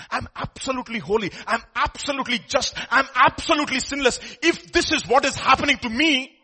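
emphatic preacher voicing Jesus; forceful preaching. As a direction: declaim it in rising repeated phrases.

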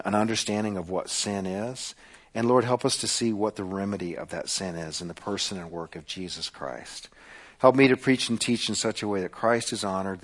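low, soft, reverent prayer. Real, not acted. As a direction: pray quietly on the congregation's behalf, letting the ends sink away. Leads the congregation in prayer.

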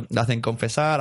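usual speaking voice

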